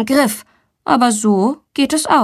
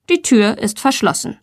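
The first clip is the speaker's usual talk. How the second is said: ordinary delivery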